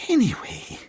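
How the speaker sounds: high, awkward change of subject. Acted. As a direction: move on from an uncomfortable moment. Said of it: "Amy said awkwardly".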